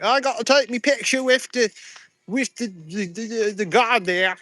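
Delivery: high, clumsy mock British-Texas accent; a botched tourist accent, somewhere between British and Texas; a bad mixed British or Texas accent, pitched high; terrible fake accent. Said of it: "That was a terrible accent".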